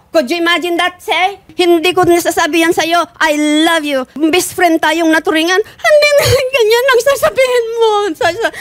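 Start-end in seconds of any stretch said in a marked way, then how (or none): 5.6-8.5 crying out. Real, not acted. Wife crying out at her husband in anguish. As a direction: voice cracking into sobs at him.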